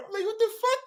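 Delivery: ordinary delivery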